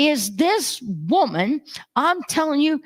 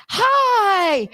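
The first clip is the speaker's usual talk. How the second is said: high, drawn-out hi; mock-delighted greeting from the greeter; imitated excited greeter; 9 semitones higher, 3 dB louder than usual. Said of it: "He goes".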